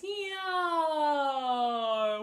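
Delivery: big open sigh sliding down; loud yawning sigh. Shown as a demonstration. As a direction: let out a full yawn sound. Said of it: "Just make a couple yaws".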